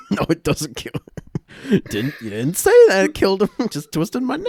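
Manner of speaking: high, loud laughing protest. Real, not acted. Big man player protesting the neck twist, laughing.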